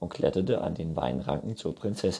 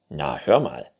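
louder and lower, affronted unicorn; indignant; 4 semitones lower, 8 dB louder than usual. A narrator's reading. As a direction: protest as if insulted. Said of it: "sagte das Neinhorn empört". (empört, mit Nachdruck)

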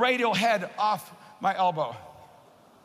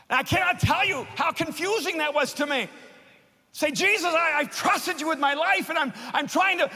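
higher, louder pleading sermon voice; impassioned preaching; 6 semitones higher, 3 dB louder than usual. Acted. Preacher reliving his prayer to Jesus, pleading and raised. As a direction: cry it out to Jesus in earnest.

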